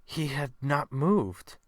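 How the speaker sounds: ordinary delivery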